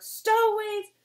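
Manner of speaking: high, loud raging shout; furious roar. A narrator's reading. Captain explodes in fury, shouting threats at stowaways. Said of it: "he exploded again".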